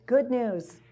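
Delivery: normal talking voice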